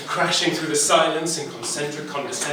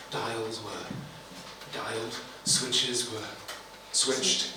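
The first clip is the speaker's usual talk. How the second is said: plain reading voice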